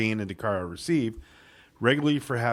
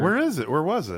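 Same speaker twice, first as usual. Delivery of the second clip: alarmed, much higher where; surprised question; 7 semitones higher than usual. Real, not acted. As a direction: jump up in pitch, startled. Panicked search for lost audio.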